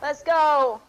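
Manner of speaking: loud hyped cheer; rallying call; excited shout. Real, not acted. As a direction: shout it with energy. Hype shout.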